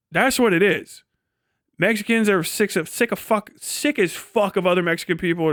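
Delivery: usual speaking voice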